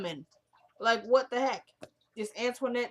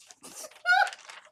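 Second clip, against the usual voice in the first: high laugh; 3 dB louder than usual. Real, not acted. Laughing at her own joke.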